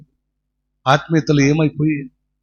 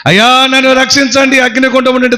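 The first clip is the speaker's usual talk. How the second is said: higher, desperate cry for help; crying out for rescue; 8 semitones higher, 3 dB louder than usual. Acted. Church secretary in hell fire begs for rescue, desperate cry. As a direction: call out to be saved from the fire. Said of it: "Agnelo కేకలేస్తున్నాడు".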